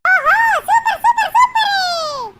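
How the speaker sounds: high, loud delighted laughing cheer; excited laughing shout. Acted. Free saree offer delights her.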